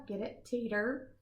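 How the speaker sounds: plain reading voice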